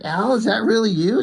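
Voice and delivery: high-pitched imitation of an amazed older woman; disbelieving mother-in-law. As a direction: raise the voice, play her incredulous. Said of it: "She's like".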